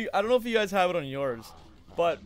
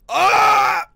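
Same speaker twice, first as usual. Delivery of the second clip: loud, high yell of annoyance; frustrated shout; 16 dB louder than usual. Real, not acted. Streamer shouting as the speedrun skip fails. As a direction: yell out at the mistake.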